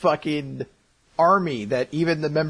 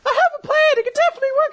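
very high falsetto shouting; hammed-up girlish protest, pitched way up; desperate insistence; 4 dB louder, much faster than usual. Mavis arguing with Makarov, frantic falsetto insistence.

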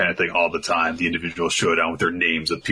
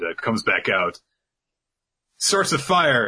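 usual speaking voice

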